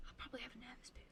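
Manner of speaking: soft conspiratorial whisper. Real, not acted. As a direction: whisper it as a confession. Whispered joke.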